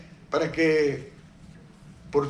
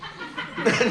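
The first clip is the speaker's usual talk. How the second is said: breathy laugh; 4 semitones higher than usual. Real, not acted. Laughing at his own joke.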